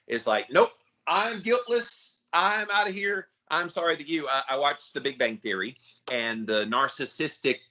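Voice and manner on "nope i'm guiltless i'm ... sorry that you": haughty dismissal. Retold or imitated. Friend mocking a non-apology, high and haughty. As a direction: brush it off like you're above blame. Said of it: "a haughtiness when it's".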